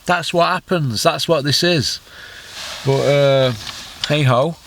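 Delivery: ordinary talk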